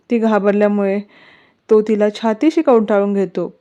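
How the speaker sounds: ordinary delivery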